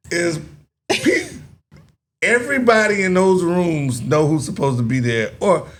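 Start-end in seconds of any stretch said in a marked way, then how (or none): none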